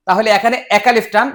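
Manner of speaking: ordinary talk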